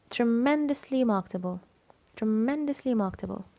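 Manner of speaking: "tremendously" stressed on its second syllable, "men". Shown as a demonstration. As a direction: punch "men" inside "tremendously". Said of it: "M E N". (التشديد على "men" في "tremendously")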